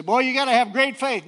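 high, loud mock amazement; mimicked admiration. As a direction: imitate people gushing over great faith. Mimics admiring people in sermon.

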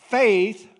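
"faith" said high and loud on its own; stress on faith. Shown as a demonstration. Breaking down the verse for the congregation, "faith" punched. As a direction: hit "faith" like a headline. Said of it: "Let's break that down".

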